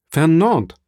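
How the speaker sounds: lauter, höher gerufener Name; Ausruf. A narrator's reading. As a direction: ruf ihn empört beim Namen. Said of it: "rief Mercedes". (Mercedes ruft Fernand empört an)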